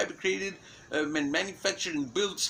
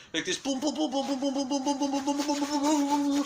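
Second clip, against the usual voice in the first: mock boom sounds; 10 semitones higher than usual. Imitating messages flying everywhere.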